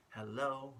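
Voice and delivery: ordinary delivery, nothing marked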